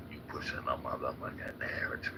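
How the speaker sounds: low, whispered imitation of critics; mock whispered accusation. Mocking critics who accuse him, whispered low. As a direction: hiss it like a sneering accuser.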